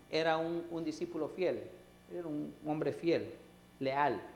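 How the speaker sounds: normal talking voice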